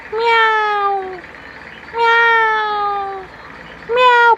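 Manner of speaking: tiny clipped kitten meows, repeated; short cat meows. Retold or imitated. Showing viewers how Ash meows, short high meows. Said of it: "ganito lang siya magmiyaw".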